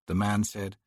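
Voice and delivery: ordinary talk, nothing marked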